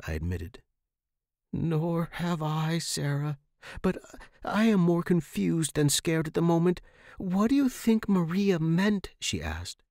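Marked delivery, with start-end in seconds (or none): 1.5-6.8 friend admitting confusion rather than fear; calm young friend. Acted. Megan answers, calmer. 7.2-9.1 higher, wondering question; young friend asking what it meant; puzzled young friend. Acted. Megan asks.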